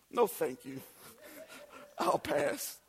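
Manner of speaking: soft, understated polite decline; lightly acted courteous brush-off; mock polite refusal. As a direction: quietly turn down an unwanted offer, play-acting. Politely declining sickness in a sermon.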